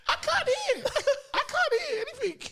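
high laughing mock panic. Kid with blocked ears telling friends, laughing high. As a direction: squeak it out, laughing. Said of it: "I'm like".

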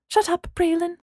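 high, sharp exasperation; exasperated. A narrator's reading. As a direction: snap at him. Smacking him, exasperated.

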